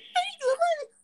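very high-pitched squeal; cartoonish high strain; frantic character voice. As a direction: squeal it far above your normal voice. Repeats the character's line.

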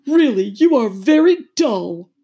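high, loud, slow scolding; cross, drawn-out turtle voice; angry Mock Turtle. A narrator's reading. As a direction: scold in a woeful voice. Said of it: "said the Mock Turtle angrily".

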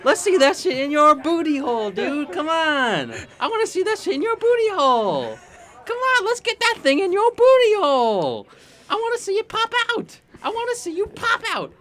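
high, building frenzied cheering; hyped cheering. Acted.

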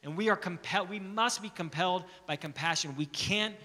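loud, rising, impassioned preaching; preaching at full force. Acted. Pastor urging his church to share the gospel, preaching at full force. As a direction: drive it home to the congregation.

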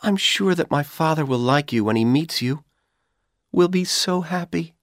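put-on hopeful young woman's voice; Dorothy, young and hopeful. A narrator's reading. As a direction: lift the voice into the eager young woman.

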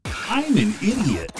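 high, slow, drawn-out catchphrase; exaggerated high self-mocking one-liner; catchphrase drop. Shown as a demonstration. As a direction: stretch it out high for the sound drop. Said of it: "Any other drops?".

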